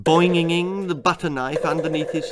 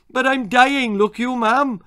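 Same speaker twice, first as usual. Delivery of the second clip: put-on Welsh boy crying out; 10 semitones higher, 6 dB louder than usual. Bleeding boy cries out.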